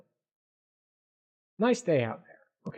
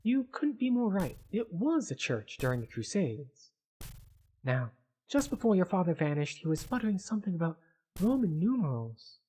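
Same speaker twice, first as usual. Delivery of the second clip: slightly raised, helpful guide voice; lightly voiced female scholar; 5 semitones higher than usual. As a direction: lightly play a knowledgeable doctor explaining. Voices Elise, guide at the library.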